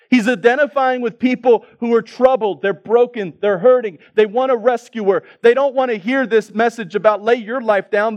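high, loud preacher delivery; impassioned preaching. Acted. Preacher identifying with troubled, hurting people, impassioned preaching.

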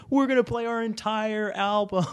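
mock rock-star announcement; quoting the lead singer in a high put-on voice; mimicking the singer.

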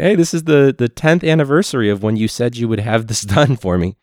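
high, loud sarcastic nagging; mock nagging. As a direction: needle them with a loud fake-cheery reminder. Mock call to district office.

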